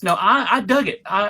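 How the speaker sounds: ordinary talk, nothing marked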